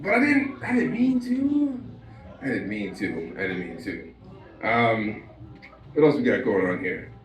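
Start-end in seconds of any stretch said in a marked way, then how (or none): 0.0-1.8 high, slow whiny protest; whiny kid. Retold or imitated. Kid pleading his innocence in a high whine. 2.4-4.1 lower, softer mumble; mumbled repeat. Retold or imitated. Comic repeats the excuse in a low, soft mumble.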